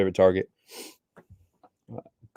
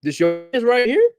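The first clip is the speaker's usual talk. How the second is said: mock viewer voice; 4 dB louder than usual. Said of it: "People hopping the stream like".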